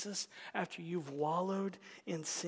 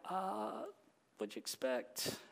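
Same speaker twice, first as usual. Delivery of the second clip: mock cat shrug; 7 dB quieter than usual. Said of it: "screaming cat meme".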